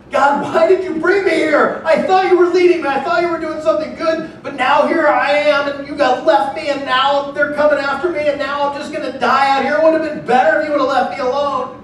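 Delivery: a frightened believer begging God; panicked prayer. Acted. Believer crying out to God, high desperate plea.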